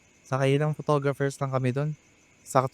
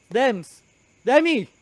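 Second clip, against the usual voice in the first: sharp raised call of her name; 11 semitones higher, 4 dB louder, much slower than usual. Acted. Brother shouting after his sister, loud call.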